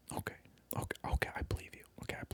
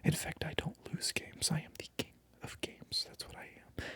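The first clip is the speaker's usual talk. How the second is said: normal talking voice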